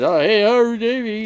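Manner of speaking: high, loud, sing-song fake hello; hammed-up chirpy greeting to Davey; mock cheery greeting. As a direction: mimic his breezy, careless hi. Mocking what the other man said.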